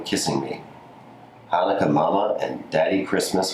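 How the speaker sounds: ordinary delivery, nothing marked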